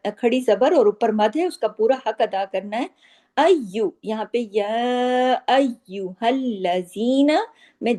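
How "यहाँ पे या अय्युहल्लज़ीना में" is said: अय्युहल्लज़ीना slow, with a firmly joined doubled ल; tajweed demo. Shown as a demonstration. Teacher showing students the doubled letter, slow. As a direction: press the shadda in अय्युहल्लज़ीना hard. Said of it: "सख्ती से जोड़कर पढ़ना है".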